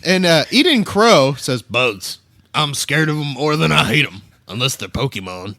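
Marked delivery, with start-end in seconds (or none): 1.6-5.6 a hint of a gruff hillbilly; lightly voiced rough country reader. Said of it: "read it as, like, like a gruff hillbilly".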